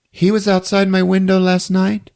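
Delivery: put-on kid voice. Kid telling Yuso.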